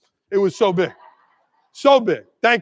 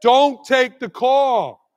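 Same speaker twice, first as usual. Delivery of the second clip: emphatic shout; much slower than usual. Real, not acted. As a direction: hammer the rule home.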